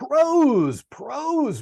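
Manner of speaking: high, loud burst on "Prose"; excited exclamation. Real, not acted. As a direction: blurt "Prose" loudly as the word lands. Reacting to a thrown word.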